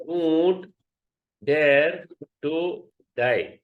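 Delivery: slow, word-by-word weight on "don't dare to die"; emphatic. Shown as a demonstration. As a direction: draw out "dare", pausing between words. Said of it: "emphatically, it means".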